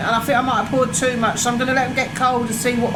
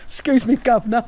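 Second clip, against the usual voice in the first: mock posh governor voice. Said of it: "I don't talk like that".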